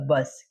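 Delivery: बस with a short uh vowel. Shown as a demonstration. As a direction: say बस with uh in place of yoo. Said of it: "यू का उच्चारण अक्सर अ होता है". (बस में छोटा अ स्वर)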